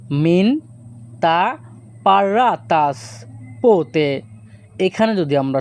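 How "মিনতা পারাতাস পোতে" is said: slow and clear. Shown as a demonstration. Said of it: "এই বাক্যের Greek ভাষা হবে".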